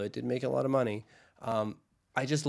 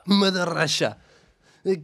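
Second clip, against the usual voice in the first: loud, higher hammed-up Russian accent; 5 semitones higher, 5 dB louder than usual. Co-host clowning for his partner as a Russian, loud accent. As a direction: proclaim it like a proud Russian, accent laid on thick. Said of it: "you do a good Russian accent".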